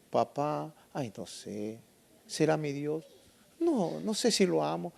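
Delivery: quiet, hesitant mumbling with doubt; unsure believer. Acted. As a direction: falter softly, as if unable to say it. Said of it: "que no puede decirle".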